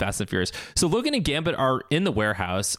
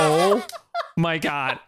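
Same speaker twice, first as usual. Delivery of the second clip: half-laughing disbelief; laughing oh my god; 2 semitones higher, much slower than usual. Retold or imitated. Recapping a show, she breaks up laughing at the joke. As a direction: gasp it out through a laugh. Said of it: "And I was like".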